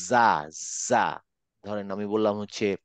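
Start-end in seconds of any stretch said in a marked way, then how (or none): 0.1-1.2 জা drawn out slowly with buzz; slow voiced জা, vibrating. Shown as a demonstration. Teacher shows class voiced জা. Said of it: "কিন্তু আমি যদি বলি".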